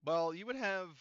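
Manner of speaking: ordinary talk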